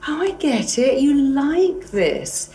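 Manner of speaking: realising, slowly; the mind catching on, drawn out; high, slow "oh I get it"; voicing the mind. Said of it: "your mind's like".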